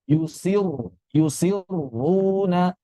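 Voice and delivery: drawn-out yusiruna with the ra not bounced; slow tajwid demo of yusiruna. Shown as a demonstration. Teacher reciting yusiruna slowly for students. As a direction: recite yusiruna slowly, keeping the ra smooth and unbounced. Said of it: "ingat jangan dipantulkan".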